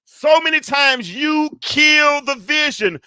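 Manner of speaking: high, slow, ringing proclamation; full-force preacher's cry; impassioned preaching. Real, not acted. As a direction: burst out at the congregation, stretching each word. Preaching bursts out after hushed aside.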